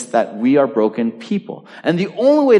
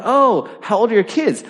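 lively retold question; 9 semitones higher than usual. Retold chat with a parent.

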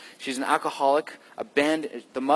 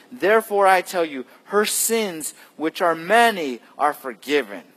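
building sermon read, louder toward the end; rising preaching; 5 semitones higher, 3 dB louder than usual. Acted. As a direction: proclaim it, pressing each line harder. Jesus's rebuke read aloud.

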